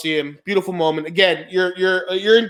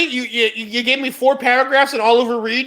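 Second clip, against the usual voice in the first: ordinary delivery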